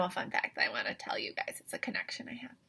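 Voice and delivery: fast unvoiced secret whisper. Real, not acted.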